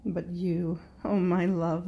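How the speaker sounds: ordinary delivery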